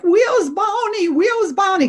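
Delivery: put-on high, slow childish whine; high kid voice calling for Barney; kids whining for Barney. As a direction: plead like a little kid wanting Barney.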